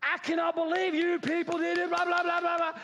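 high, hammed-up indignant rant; scolding outburst. Self-righteous churchgoer scolding you people, mock outrage. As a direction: burst out high, running into blather.

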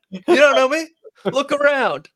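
very loud, high mock bravado; hammed-up bravado, rising at the end; fake tough guy swagger. Repeats mock brag, louder still.

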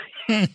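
laugh. Real, not acted. Laughs at his own bit.

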